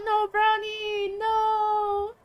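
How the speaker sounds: high, slow singsong no to the pet; singsong pet scolding. Real, not acted. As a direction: draw it out like scolding a dog.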